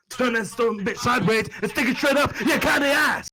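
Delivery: loud wrestler threat climax; promo mode. Acted. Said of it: "I just go promo mode".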